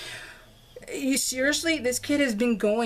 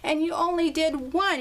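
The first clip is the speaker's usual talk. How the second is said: higher-pitched mock telling-off; retold scolding; 8 semitones higher than usual. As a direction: imitate an exasperated dad reaming out his son. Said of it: "just a reaming".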